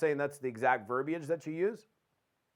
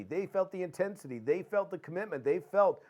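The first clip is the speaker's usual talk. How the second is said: normal talking voice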